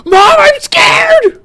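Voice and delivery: piercing, very high drawn-out shriek; full-out frightened wail; terrified scream. Acted. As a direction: scream in terror for your mother. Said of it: "I actually scream when I'm doing this".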